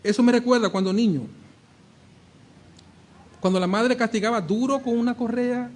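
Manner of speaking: ordinary talk, nothing marked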